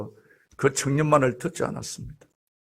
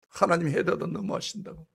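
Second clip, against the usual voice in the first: ordinary talk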